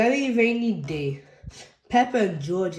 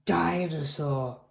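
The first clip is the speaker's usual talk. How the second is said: lower, slow dinosaur growl; George growling; 4 semitones lower, 3 dB quieter, much slower than usual. A narrator's reading. George showing his toy dinosaur, low growl. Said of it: "growls George".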